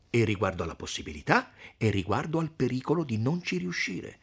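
ordinary delivery

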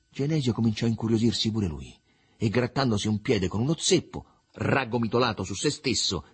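ordinary delivery, nothing marked

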